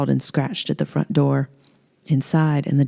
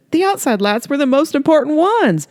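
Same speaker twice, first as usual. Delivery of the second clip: higher, dismayed outburst; exasperated Earl; 11 semitones higher, 4 dB louder than usual. Acted. Earl realizing the outside lights are on, dismayed. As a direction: tell her in disbelief.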